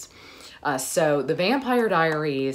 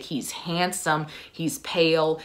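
ordinary talk

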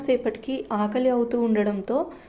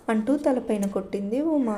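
plain reading voice

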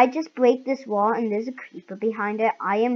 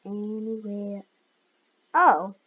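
usual speaking voice

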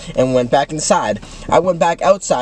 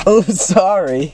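slow, drawn-out mock apology; mocking echo; 2 semitones higher, much slower than usual. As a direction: parrot back oh, sorry in a silly voice.

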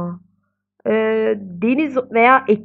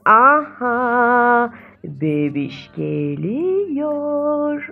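excited; 3 semitones higher, much slower than usual. Real, not acted. Sim going into labour.

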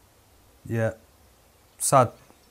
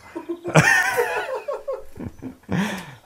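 a long high cackle falling off; high burst of laughter. Real, not acted. Loud high laugh after joke.

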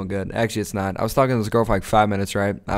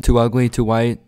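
ordinary talk, nothing marked

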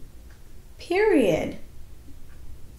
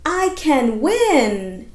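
loud, slow, bright cheer of a sentence; excited; 3 semitones higher, 5 dB louder, much slower than usual. Shown as a demonstration. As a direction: read it with lots of excitement. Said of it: "read it with a lot of excitement".

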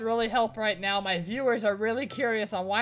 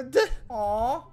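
ordinary delivery

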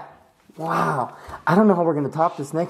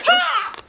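high giggles. Real, not acted. After game, high giggle.

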